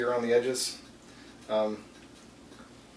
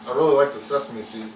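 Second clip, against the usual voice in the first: mouth full. Real, not acted. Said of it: "as I'm talking with my mouth full".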